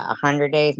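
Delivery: ordinary talk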